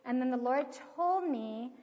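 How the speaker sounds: usual speaking voice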